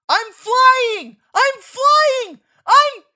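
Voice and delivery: high, loud exultant yell; thrilled shouting. Acted. Child tossed off a gorge ledge, loud high thrilled yell. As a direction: scream with joy in midair.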